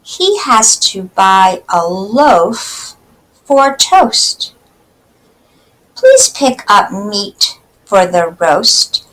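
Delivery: put-on slow, clear poem reading for kids; slow read-aloud. Teacher reading a poem slowly to children.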